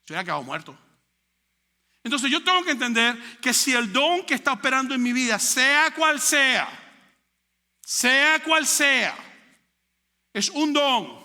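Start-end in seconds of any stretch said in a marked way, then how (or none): 2.0-5.4 louder, higher preaching push; fiery preaching. Acted. Preacher pressing the church on the gifts, louder and higher. 5.4-6.7 sea cual sea stretched very slow, louder and higher; drawn-out emphasis. Acted. Preacher hammering sea cual sea to the church, very slow and loud. 7.8-9.2 sea cual sea stretched very slow, louder and higher; drawn-out emphasis. Acted. Preacher hammering sea cual sea to the church, very slow and loud.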